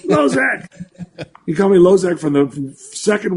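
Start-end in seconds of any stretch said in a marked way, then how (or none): 0.1-1.3 higher, laughing call of a nickname; imitating mentor calling. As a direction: call out the name with a laugh like an excited old mentor.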